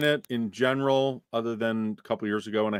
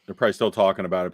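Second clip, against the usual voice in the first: ordinary talk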